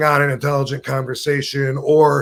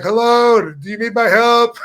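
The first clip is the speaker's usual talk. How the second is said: a higher, louder call for anyone inside; 9 semitones higher, 4 dB louder than usual. Retold or imitated. Rob calls into the closed restaurant offering help, loudly. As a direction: offer help to the dark room.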